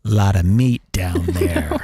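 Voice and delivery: low, slow, drawn-out appraisal; guy's private remark. Acted. Red-blooded guy scrolling Instagram thinks to himself, low slow aside. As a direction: sink deep and take your time. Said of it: "I'll say this in my head".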